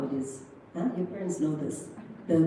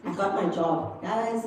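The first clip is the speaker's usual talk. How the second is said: ordinary talk, nothing marked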